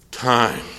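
"time" set apart after a long pause; dramatic pause. Real, not acted. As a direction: hold back, then drop "time" alone. Long pause before the punch word.

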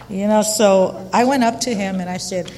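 ordinary talk

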